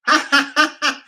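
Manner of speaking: high, loud rapid-fire fake laugh; imitated emoji laughter. Shown as a demonstration. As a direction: demonstrate a laughing emoji, hammed-up. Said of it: "this is what the laughing emojis are doing".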